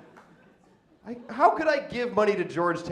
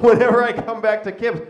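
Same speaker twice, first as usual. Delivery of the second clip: usual speaking voice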